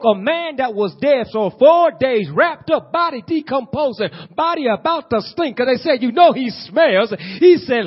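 high and loud rising sermon cry; preacher hitting his peak; preaching at full force. Acted. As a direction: build the fervour toward the miracle. Preaching climax about Lazarus.

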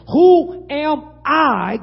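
loud, high, hugely drawn-out words; dramatic protest. Acted. Moses questioning God's call, dramatic drawn-out cry. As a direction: cry it out word by word, stretching each one.

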